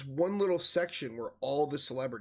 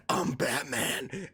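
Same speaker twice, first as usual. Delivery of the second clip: slow, rough superhero growl; hammed-up dark hero impression; Batman; much slower than usual. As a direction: growl it out slowly, in mock menace. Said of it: "grabs his shirt and goes".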